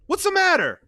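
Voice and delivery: frightened asking; Mama asking Sonny what happened, anxious. Acted. Mama worried.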